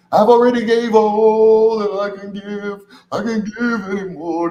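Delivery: high, slow, put-on woman's voice; whiny imitation of a woman; mock whiny woman. Mimicking women who gave too much.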